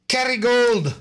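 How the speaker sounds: mock Irish brogue selling butter; terrible Irish accent. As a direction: ham up a bad Irish lilt. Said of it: "just a terrible, terrible impression".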